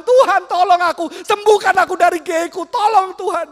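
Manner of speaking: loud, high pleading to God; a desperate boy's cry to God; desperate prayer. Acted. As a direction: beg God for help at full voice.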